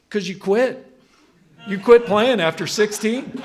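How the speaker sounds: high, loud, laughing through the words; can barely get it out for laughing; laughing retold quote. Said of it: "And she".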